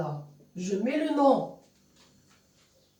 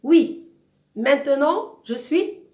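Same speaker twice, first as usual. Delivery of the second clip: higher, louder, emphatic resolve; decided; 5 semitones higher, 6 dB louder, slower than usual. Acted. Teacher voices the runaway teen's monologue, decided. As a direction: declare it as a firm decision. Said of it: "montre vraiment la décision".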